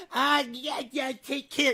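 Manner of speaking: high, strained parody of a reluctant politician; mocking her forced reply. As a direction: squint and force the words out. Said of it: "She had such a hard time saying".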